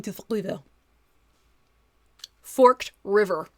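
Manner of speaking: put-on French exchange student's heavy accent; thick French accent. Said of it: "very thick French accent".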